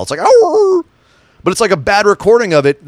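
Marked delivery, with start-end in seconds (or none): 0.2-0.8 high, loud yelped howl; bad wolf howl. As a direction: yelp it like a bad fake wolf. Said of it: "it's like a wolf howl".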